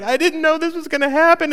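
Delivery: high, loud, choked-up imitation; mock weepy gush; tearful mom. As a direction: mimic a mom crying with joy. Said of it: "the tears are flowing".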